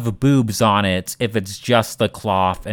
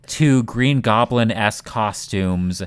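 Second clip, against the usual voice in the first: usual speaking voice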